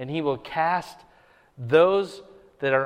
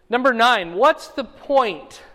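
emphatic announcement; 8 semitones higher, 8 dB louder, slower than usual. Acted. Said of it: "preacher announces point".

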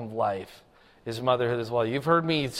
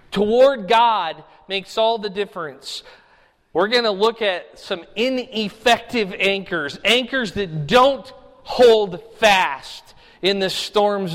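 louder, higher, fervent sermon delivery; full preaching; 8 semitones higher, 8 dB louder than usual. Acted. Pastor urging the congregation to rejoice, preaching at full force. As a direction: drive the point home from the pulpit.

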